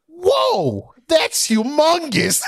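hammed-up squeal of a surprised lady at the counter; mocking the lady's yell. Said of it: "this bitch just yelled out".

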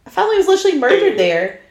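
high, loud disbelieving rant; exasperated outburst. Real, not acted. Host ranting to co-host about the new owners, high and loud with disbelief. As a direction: throw up your hands at their stupidity.

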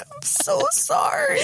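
high slow apology. Retold or imitated. Imitating own apology.

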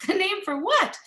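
sharp high rise of disbelief; incredulous high question; baffled. Retold or imitated. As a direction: shoot up in confused surprise. Baffled reaction to friends' idea.

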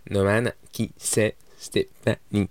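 slow, deliberate interrogation, word by word; accusing demand. Retold or imitated. Girlfriend confronts the sleeping narrator, slow and accusing. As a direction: spell out the accusation slowly.

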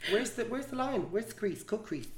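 normal talking voice

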